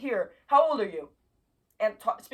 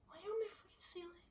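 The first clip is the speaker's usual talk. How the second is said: hushed; 10 semitones higher, 16 dB quieter, faster than usual. Real, not acted. Talking to bug on ceiling.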